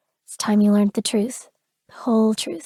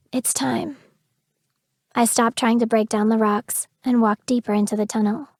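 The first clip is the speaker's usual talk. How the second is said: ordinary delivery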